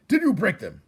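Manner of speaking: high, loud accusing question; sharp demand. Acted. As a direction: pin him down hard. Judge demanding answer.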